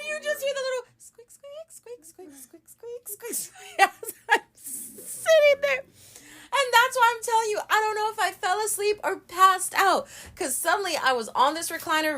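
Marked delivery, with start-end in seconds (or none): 0.1-5.8 squeak imitation.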